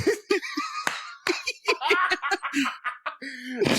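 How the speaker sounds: high, drawn-out helpless laughter; long laughing fit. Real, not acted. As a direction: crack up for several seconds, high-pitched. Cracks up after tone remark.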